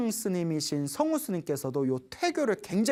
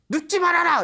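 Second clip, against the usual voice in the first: higher, louder urgent cry; mother's loud warning; 11 semitones higher, 6 dB louder than usual. Retold or imitated. Mother warning her son in the barracks, urgent loud cry. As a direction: shout the warning like a frightened mother. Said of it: "엄마 목소리가 크게 들리더라는 거예요".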